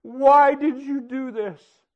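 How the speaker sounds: crying out at God in grief; mild retold scream at God; retold anguished yell. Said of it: "yelling at the top of my lungs and screaming at him".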